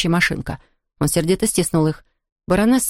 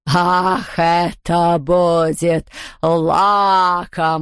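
slow, savouring villainess voice; drawn-out predatory delight; gloating ghost; much slower than usual. A narrator's reading. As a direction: relish it, stretching every word. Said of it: "прошипела она".

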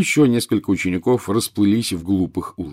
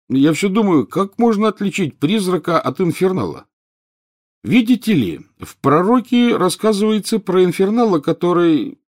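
high, louder schoolboy voice; eager schoolboy; 11 semitones higher, 5 dB louder than usual. Acted. Pupil asking the teacher about the newspaper, eager and high. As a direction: pipe up with an eager question.

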